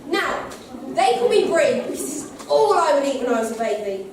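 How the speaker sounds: usual speaking voice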